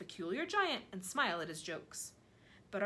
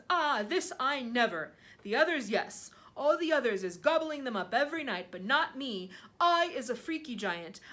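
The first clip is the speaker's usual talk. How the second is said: loud, emphatic, indignant giant; mighty giant shouting; 3 semitones higher, 7 dB louder than usual. A narrator's reading. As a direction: boom out a proud protest as the giant. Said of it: "his mighty voice".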